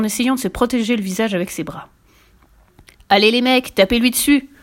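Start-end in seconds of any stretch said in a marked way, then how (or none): 3.1-4.5 loud egging-on shout; yelled. A narrator's reading. Bengt urging his gang to hit Willy, loud yell. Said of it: "hurla le plus grand".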